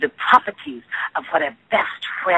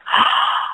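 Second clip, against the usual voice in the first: sharp breathless gasp of surprise; imitated gasp. Retelling the first bite of a donut peach, a sharp gasp. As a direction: mimic a sudden amazed intake of breath. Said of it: "and I was like".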